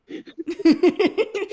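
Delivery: sudden high, loud laugh; real laughing fit; loud burst of laughter. Real, not acted. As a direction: crack up out loud at the joke. Subscribe to yourself joke.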